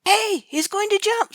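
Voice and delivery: high slow alarmed shout. A narrator's reading. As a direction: yell it out across the desert. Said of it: "someone shouted".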